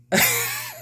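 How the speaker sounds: sudden squeaky laugh; a short incredulous laugh; high burst of laughter. Real, not acted. Laughing at the comic.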